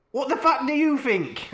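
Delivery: hammed-up angry retort, pitched high. A narrator's reading. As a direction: snap it in mock outrage. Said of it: "I asked angrily".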